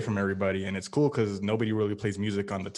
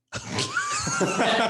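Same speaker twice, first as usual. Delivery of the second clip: very high, explosive laugh. Real, not acted. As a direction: burst out laughing at the punchline. Punchline about his first joke.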